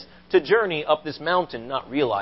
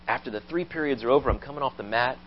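ordinary talk

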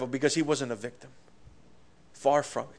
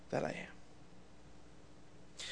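slow, breathy whispered reverence; 21 dB quieter, much slower than usual. Acted. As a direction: drop to a slow awed whisper. Hushed climax of declaration.

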